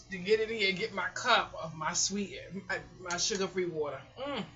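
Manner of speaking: usual speaking voice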